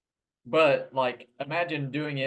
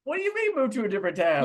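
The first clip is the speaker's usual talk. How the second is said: much higher teasing disbelief, then louder; incredulous; 11 semitones higher, 4 dB louder than usual. Real, not acted. Co-host teasing friend's request, incredulous echo. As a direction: throw the request back in mock confusion.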